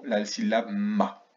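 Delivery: ordinary talk, nothing marked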